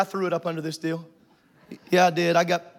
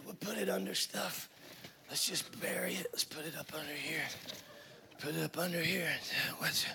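soft, breathy hush; conspiratorial whisper; 4 semitones lower, 15 dB quieter than usual. Pretend. Hiding the mess under the table, whispered. Whispering while tucking things away.

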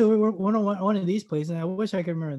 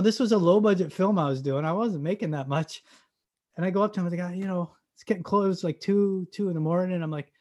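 ordinary talk

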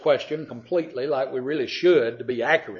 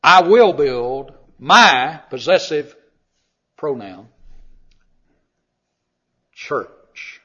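slow, with a long hanging pause before a dropped, breathy "church."; dramatic pause; 7 dB louder, much slower than usual. Acted. Preacher builds suspense before naming the word. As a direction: hold the silence, then let "church." fall almost voiceless.

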